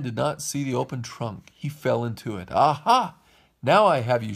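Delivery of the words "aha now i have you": loud, triumphant villain shout; terrible shouting voice. A narrator's reading. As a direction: pounce on it loudly like a storybook villain. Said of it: "shouted Strombolli in a terrible voice".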